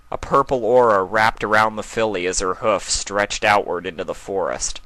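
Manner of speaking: ordinary talk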